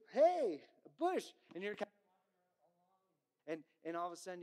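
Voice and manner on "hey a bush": high, loud cheerful hey, a bush; mock surprise. Preacher voicing a sprouting bush, high loud excitement. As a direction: pop out the words like a bush springing up.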